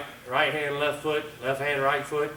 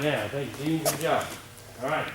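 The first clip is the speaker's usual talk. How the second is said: ordinary talk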